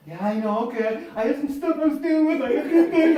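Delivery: hammed-up teammate excuses; imitating Tracy. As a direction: play the sheepish teammate.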